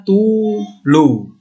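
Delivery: tulu drawn out slowly with a clear u; teacher modelling tulu; saying tulu. Shown as a demonstration. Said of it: "ini tulu namanya".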